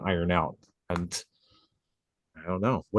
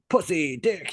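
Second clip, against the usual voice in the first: high-pitched imitation of giddy cursing; mock rowdy outburst; 8 semitones higher, slower than usual. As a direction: toss out random curses like a hyped-up client. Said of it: "saying random curse words and shit".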